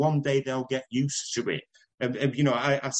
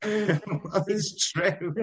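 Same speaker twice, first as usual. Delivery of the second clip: laughing; 8 semitones higher, much slower than usual. Real, not acted. Still laughing after the joke.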